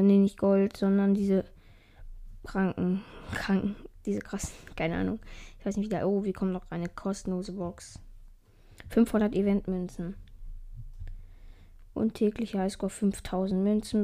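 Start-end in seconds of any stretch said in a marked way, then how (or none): none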